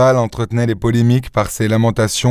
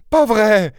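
très aigu, incrédule; n'y crois pas; exclamation incrédule; 12 semitones higher than usual. Acted. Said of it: "s'exclama-t-elle". (épouse qui refuse d'y croire, aiguë)

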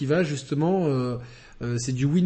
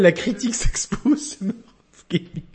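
mock announcement; 5 semitones higher than usual. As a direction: announce the fake show name like a grand trailer. Mocking the show's title.

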